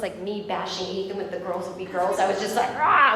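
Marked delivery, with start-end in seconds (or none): 2.8-3.1 loud, high "rah" of rage; acted-out rage noise; enraged growl. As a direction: burst out with a mock roar. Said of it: "I was in a rage".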